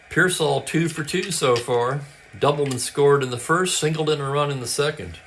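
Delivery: brisk radio ballgame call, lightly lifted; live play-by-play. Acted. Host calling a tabletop baseball game, play-by-play voice. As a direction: call it like a classic radio announcer.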